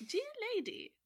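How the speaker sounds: high, sly creature voice; Tanuki voice. Acted. Wily Tanuki sweet-talks the old wife in a high voice. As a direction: put on a wily animal's high coo. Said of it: "what's a Tanuki voice?".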